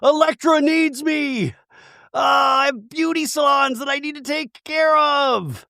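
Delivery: high, whiny mock plea to go home; Angie pleading. Angie begging to get home to her family, whiny. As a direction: pitch up into a pleading whine. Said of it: "we hear Angie go".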